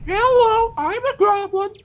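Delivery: very high squeaky goblin; cheerful shrill little goblin; friendly goblin voice. Acted. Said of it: "It's like my friendly goblin voice".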